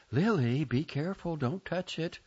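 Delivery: a mother calling her daughter back in alarm; mother's warning. Retold or imitated. Mother warning Lily away from the dead cat, high alarmed call.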